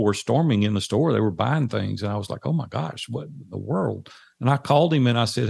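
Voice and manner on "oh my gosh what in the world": stunned amazement. Retold or imitated. Customers storming into the store.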